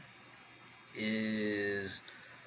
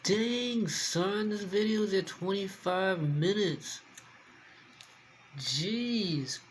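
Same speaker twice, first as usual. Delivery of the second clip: surprised; 8 semitones higher, much slower than usual. Real, not acted. Surprised at video length.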